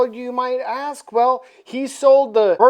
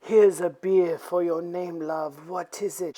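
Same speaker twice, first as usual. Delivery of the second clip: softer, slightly lowered Count; lightly voiced suave Dracula; 3 semitones lower, 3 dB quieter than usual. Dracula offering his prey a beer, lightly suave. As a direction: chat her up low and softly. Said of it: "Then Count Dracula says".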